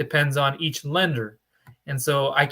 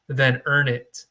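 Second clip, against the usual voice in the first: normal talking voice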